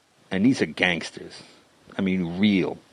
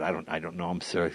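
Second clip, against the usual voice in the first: much softer, lower nervous answer to a mobster; timid reply; 5 semitones lower, 10 dB quieter than usual. Retold or imitated. Young comic answering the mob guy about Stallone, softly and nervously. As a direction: shrink back and answer politely out of fear.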